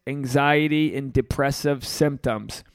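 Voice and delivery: usual speaking voice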